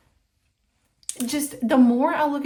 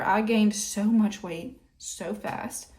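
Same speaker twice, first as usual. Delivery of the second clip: usual speaking voice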